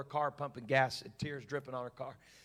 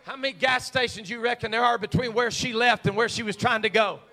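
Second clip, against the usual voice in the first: loud, high, fervent shouted preaching; preacher pleading with the congregation, shouting with rising fervour; preaching at full force; 7 semitones higher, 15 dB louder than usual. Acted. Pastor begging the church to be the body, shouting.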